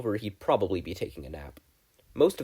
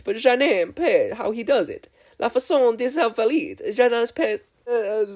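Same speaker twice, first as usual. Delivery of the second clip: put-on much higher, louder, showy French accent; French-accented flair; 12 semitones higher, 6 dB louder than usual. A narrator's reading. Aoyama shows off to the class in a French accent. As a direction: flounce through it in a French accent.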